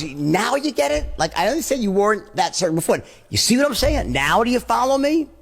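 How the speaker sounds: confident, insistent check-in; peak certainty. Shown as a demonstration. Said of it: "my tone is".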